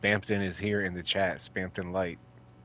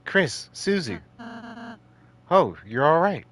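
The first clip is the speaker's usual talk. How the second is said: high, swooping voice of a relieved friend; high-pitched game friend, full of relief; 10 semitones higher, 3 dB louder than usual. Acted.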